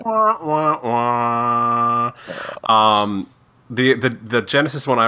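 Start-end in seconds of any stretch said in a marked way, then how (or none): 0.0-3.0 sad trombone imitation. Shown as a demonstration.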